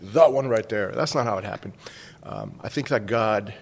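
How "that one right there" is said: loud, grand heavenly proclamation; mock divine boom from above; booming voice of God. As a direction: announce it like the heavens just opened. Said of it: "the heavens opened up and God was like".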